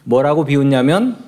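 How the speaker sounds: ordinary talk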